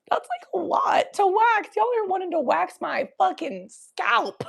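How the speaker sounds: high-pitched exaggerated protest; mock outrage. Answering people who push waxing, mock outraged. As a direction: protest it high, playing up the disbelief.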